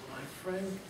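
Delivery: whisper-thin little mayor; faint tiny voice. Acted. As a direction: speak as someone too small to be seen. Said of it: "The voice was so faint".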